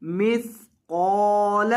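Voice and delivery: मिस्काल slowly, heavy long कॉफ; drawn-out मिस्काल with a thick qaf. Shown as a demonstration. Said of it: "कॉफ को लंबा करें और मोटा करें". (मिस्काल, कॉफ मोटा और लंबा)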